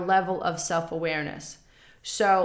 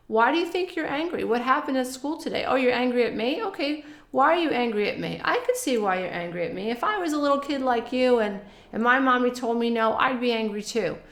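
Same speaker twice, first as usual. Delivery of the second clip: higher, quick, gently questioning parent voice; attuned parent; 5 semitones higher, faster than usual. Acted. Parent asks a child about their anger, higher brisk validating voice. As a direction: lift the pitch and chat briskly, as to a little kid.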